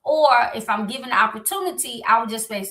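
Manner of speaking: normal talking voice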